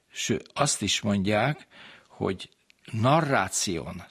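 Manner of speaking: usual speaking voice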